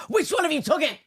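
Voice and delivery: high, loud accusing voice; mock accusation. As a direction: accuse them in a hammed-up voice. Accusing store staff.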